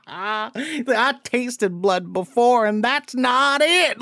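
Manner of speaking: very slow, drawn-out mock villain voice; Woody Harrelson's line. Mocking the actor's line reading to a friend, slow and drawled. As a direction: stretch it out like a bored menacing killer.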